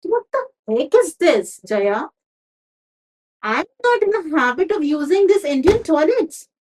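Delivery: loud, high angry outburst at his wife; furious husband. Acted. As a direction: let the anger boil over at Jaya. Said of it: "He got furious".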